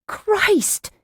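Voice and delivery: high exasperated outburst; sharply pitched exasperation; burning frustration. A narrator's reading. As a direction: burst out in sheer frustration. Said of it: "burning with frustration".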